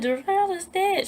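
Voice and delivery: high-pitched tearful announcement; retold crying. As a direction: re-enact breaking bad news in tears. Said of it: "Why are you crying?".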